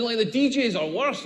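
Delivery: ordinary talk, nothing marked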